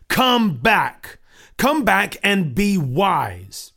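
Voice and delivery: loud, slow thundering call; bellowing. Acted. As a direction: roar at them to come back. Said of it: "bellowed Obstinate".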